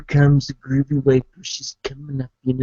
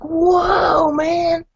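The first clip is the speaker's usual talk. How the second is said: high crying-out whoa, the last one loud; hammed-up alarm at the plate; shocked waitress; 3 dB louder, much slower than usual. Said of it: "And she's like".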